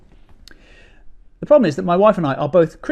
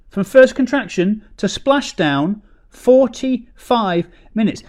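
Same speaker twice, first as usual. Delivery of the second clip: slow, weighted delivery; milk the number slowly; slow punchline; 6 semitones higher, 3 dB louder, slower than usual. Shown as a demonstration. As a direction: land it slowly, word by word. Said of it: "slow deliberate punchline".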